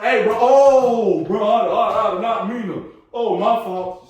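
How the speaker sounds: high, loud, stammering apology; flustered apologetic bro. Retold or imitated. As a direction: caught off guard, stumbling over the sorry. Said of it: "he said, he walked in".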